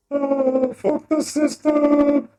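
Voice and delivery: high, loud, stretched rebel yell; hammed-up high shout. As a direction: yell it up high, slow and defiant.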